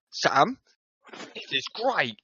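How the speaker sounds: loud declaration with a laugh breaking in; acted shout. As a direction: proclaim it loudly, half in fun.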